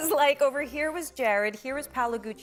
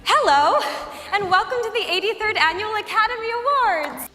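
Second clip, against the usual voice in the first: awards host; 9 semitones higher, 7 dB louder than usual. Acted. Hosting the Oscars.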